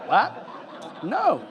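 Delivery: high, loud laughing "what, no"; laughing disbelief. Real, not acted. Interviewer laughing at the festival director's question. Hearing the festival asked about replacing text.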